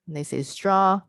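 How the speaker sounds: ordinary delivery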